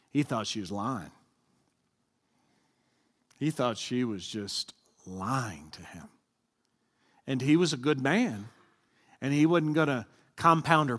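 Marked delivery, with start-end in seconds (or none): 0.1-1.1 lower, blunt and plain-spoken; not so nicely. Shown as a demonstration. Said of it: "say it not so nice". 3.4-6.1 lower, slow and heavy; not so nicely. Shown as a demonstration. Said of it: "say it not so nice".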